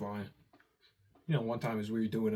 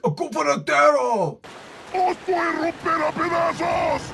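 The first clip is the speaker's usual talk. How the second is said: put-on high, screaming Spanish old villager; angry old man shout; 11 dB louder than usual. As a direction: shriek it like a Resident Evil villager. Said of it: "He came at us like".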